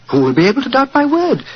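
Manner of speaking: high, triumphant villain's boast; a smug servant's triumphant crow; gloating triumph. Acted. Butler villain gloats at gunpoint.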